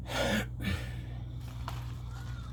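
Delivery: long breathy sigh. Real, not acted. End of an angry rant.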